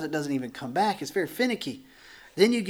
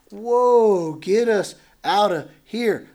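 louder, higher mock alarm, slowing on the plea; panicked cry; 5 semitones higher, 8 dB louder, much slower than usual. People voiced crying out again.

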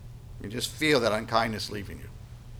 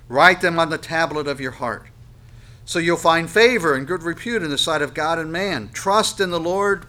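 loud, raised preaching voice; forceful scripture reading; 6 semitones higher, 6 dB louder than usual. Acted. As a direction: proclaim the verse to the whole congregation.

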